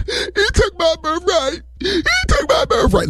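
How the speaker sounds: high, slow, blubbering wail; exaggerated mock weeping; hammed-up crying. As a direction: sob it out like a cheated brother. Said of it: "So Esau crying".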